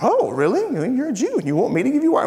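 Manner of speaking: high, fast disbelieving rush; the astonished reading he rejects; surprised take. Retold or imitated. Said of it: "I don't even think it was surprise".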